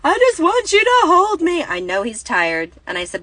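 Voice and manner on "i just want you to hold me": put-on high, loud whiny child's wail; imitating a screaming child. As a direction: mimic a tired little boy screaming to be held. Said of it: "He's screaming".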